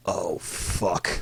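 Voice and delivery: quiet, low dread. Retold or imitated. Friend in the car sees a gun, quiet dread. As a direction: let it sink out low and hushed.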